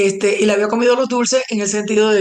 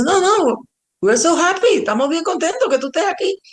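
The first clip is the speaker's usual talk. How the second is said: high-pitched, gushing reassurance; imitated delighted welcome; 9 semitones higher than usual. Music boss welcoming the newly arrived publicist, imitated high-pitched delight. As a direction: voice a thrilled host, pitched well up.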